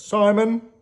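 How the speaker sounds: high, loud name call. Acted. Calling a name.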